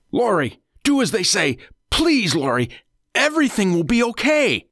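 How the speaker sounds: high, urgent plea; desperate pleading. A narrator's reading. Bound husband begging his wife to do as they say, pleading. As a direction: beg her desperately, pitch climbing.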